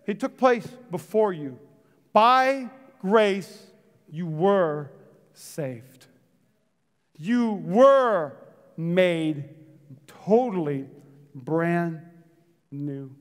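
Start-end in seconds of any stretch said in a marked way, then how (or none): none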